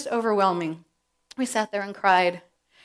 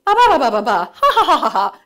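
high, loud babbling into laughter; playful babble that breaks into laughs; imitating her own chatter; 4 semitones higher, 10 dB louder than usual. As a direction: mimic a chatty mom laughing. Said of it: "She does a perfect me".

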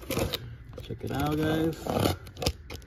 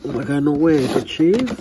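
shrieking jackpot winner; 3 semitones higher, 7 dB louder than usual. Real, not acted. Finds a chase car.

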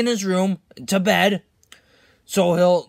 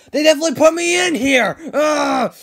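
high, loud exasperated rant; worked-up fuming; angry complaint; 7 semitones higher, 4 dB louder than usual. Acted. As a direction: vent your outrage at being shut in. Mojo furious at the kids.

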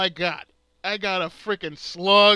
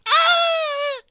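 high, loud mock squeal of the fairy getting hit; imitated battle cry; 6 dB louder than usual. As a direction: yelp it out high like a cartoon heroine. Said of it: "she gets this".

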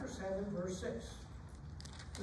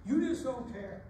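louder, higher fist-shaking complaint to God; raised-voice accusation; defiant protest; 4 semitones higher, 6 dB louder than usual. Acted. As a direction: hurl it accusingly at heaven. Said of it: "shake our fist at God and say".

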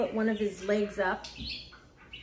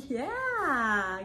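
ordinary delivery

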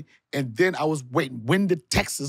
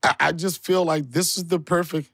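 usual speaking voice

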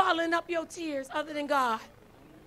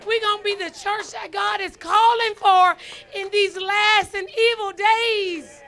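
preaching at full force; 3 semitones higher, 7 dB louder than usual. Acted. Pastor urging the church to love and do more, full-force preaching.

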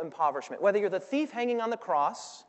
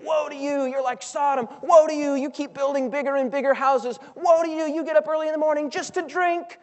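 building to a shouted cry, higher and louder; prophet's woes; 6 semitones higher, 6 dB louder than usual. Retold or imitated. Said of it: "Isaiah prophesies woe".

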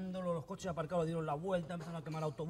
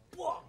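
mimicked throw; 12 semitones higher than usual. Pest hits the guru, high impact noise.

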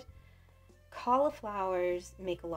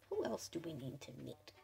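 whispering; 3 semitones lower, 10 dB quieter than usual. Real, not acted. Whispered aside.

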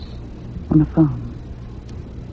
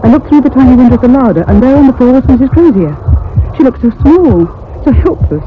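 higher, urgent narration full of dread; rising panic; 5 semitones higher than usual. Acted. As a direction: tell it racing toward the horror.

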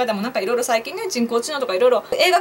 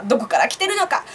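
ordinary talk

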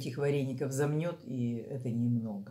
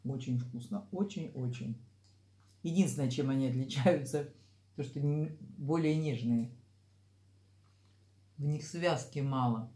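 eating between words; talking while chewing. Real, not acted. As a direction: food near the mouth. Seen 1: eats throughout, dumpling on fork at mouth; R frames show no food.

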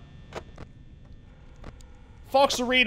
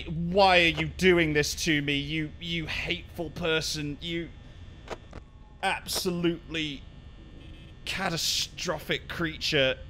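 ordinary talk

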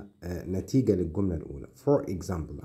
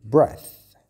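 breath said loud, short e and unvoiced th; loud demo; 5 semitones higher, 12 dB louder than usual. Shown as a demonstration. Teacher contrasts breath with breathe, loudly. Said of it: "لكن نفس".

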